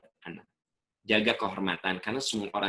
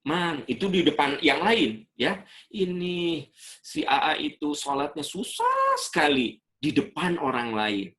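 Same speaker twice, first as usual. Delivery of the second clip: tattling parent; 6 semitones higher than usual. Retold or imitated. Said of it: "Bahkan kita suka bilang".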